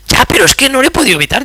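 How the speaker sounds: fast and high, sheepish excuse; a high, quick apology from the wife; wife defending herself. Retold or imitated. (esposa excusándose, más aguda y rápida)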